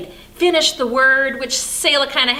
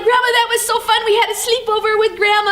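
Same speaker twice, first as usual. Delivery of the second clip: high-pitched, gleeful child imitation; imitated happy, excited chatter; excited little girls; 10 semitones higher, 4 dB louder than usual. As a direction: gush like delighted kids after a sleepover.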